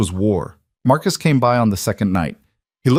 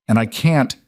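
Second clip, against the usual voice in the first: usual speaking voice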